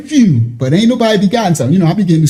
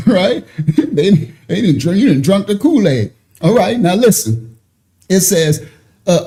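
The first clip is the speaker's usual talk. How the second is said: normal talking voice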